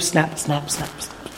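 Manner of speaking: soft, breathy snap snap snap snap; belt snap imitation. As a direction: mimic the belt leaving its loops.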